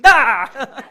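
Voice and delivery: loud sudden yelp of fright; startled yelp. Retold or imitated. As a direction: jump out of your skin. Startled by someone sneaking up.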